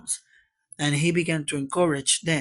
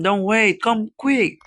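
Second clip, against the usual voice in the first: high, raised urgent voice; urgent pleading; 6 semitones higher, 5 dB louder than usual. Acted. Sid begs Aunt Polly to come, urgent pleading. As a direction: press them to hurry at once.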